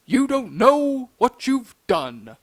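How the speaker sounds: slow, ominous cartoon-dad manager; put-on voice, drawn out in warning; George Jetson. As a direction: deliver a grave warning in a cartoon voice. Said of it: "that same George Jetson sound".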